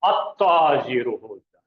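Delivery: अत्ताजीरो said low and slow, the doubled त्त held; doubled t demo. Shown as a demonstration. Teacher to students, showing the doubled letter slowly. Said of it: "यहां पे इसको double किया जाएगा".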